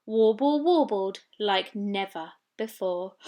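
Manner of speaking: ordinary delivery, nothing marked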